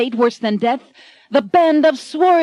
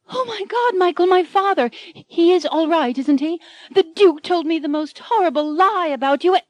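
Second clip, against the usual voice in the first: high, breathless heroine voice; alarmed heroine; 8 semitones higher than usual. Acted. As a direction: gasp it out like a frightened maiden. Anne fears for her father.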